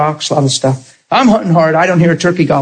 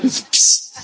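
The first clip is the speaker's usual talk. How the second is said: psst; 4 dB quieter than usual. Retold or imitated. Imitating a hiss to get attention.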